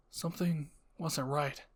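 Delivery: usual speaking voice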